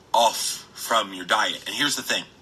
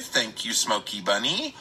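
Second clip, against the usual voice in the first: ordinary delivery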